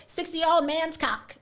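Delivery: normal talking voice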